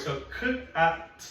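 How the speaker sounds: k and at split apart, each sound said slowly; sounding out cat. Shown as a demonstration. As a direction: break "k, at" into its sounds for a child. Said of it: "What is the first sound in cat?".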